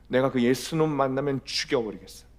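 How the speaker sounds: normal talking voice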